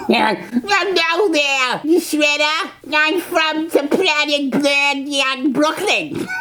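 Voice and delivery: slow, drawn-out fake Brooklyn accent for an alien brain; teasing the cartoon villain Krang with a hammed-up Brooklyn accent; mock Brooklyn accent. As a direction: ham up a Brooklyn accent, stretching every word.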